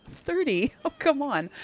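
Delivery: high-pitched, incredulous laughing protest; amused disbelief. Real, not acted. Player objecting to a letter calling thirty old, amused disbelief. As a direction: scoff at it with a laugh.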